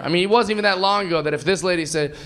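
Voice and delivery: normal talking voice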